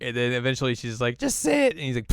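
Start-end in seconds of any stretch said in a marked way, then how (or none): 1.2-1.7 high, sharp cartoon order; mock command. Kagome orders Inuyasha to sit, high mock command. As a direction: pitch it way up as a command.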